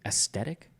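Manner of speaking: questioning "as-stetic", t for th; mispronounced aesthetic. Retold or imitated. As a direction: imitate aesthetic said with a hard t, rising. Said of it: "pronounce aesthetic, aesthetic".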